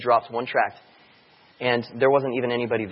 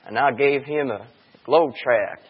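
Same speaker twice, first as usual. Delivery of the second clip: slow thick Texas drawl; Texas accent; 5 dB louder, slower than usual. Retold or imitated. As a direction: drawl it like an old Texan. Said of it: "He's got this thick Texan, Texas accent".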